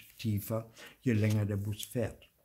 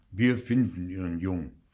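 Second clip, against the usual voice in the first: lower-pitched policeman's assurance; 4 semitones lower than usual. A narrator's reading. Policeman to Theo's mother, assuring in lower voice. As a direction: voice the officer in a lower register. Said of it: "wird der Polizist sagen".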